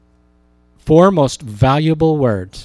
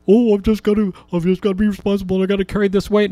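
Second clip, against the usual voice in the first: higher, fretful inner voice rushing; anxious self-talk; 6 semitones higher, faster than usual. Retold or imitated. Preacher voicing an anxious believer to the congregation, fretful and rushed. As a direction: play the worried believer who thinks it's all on him.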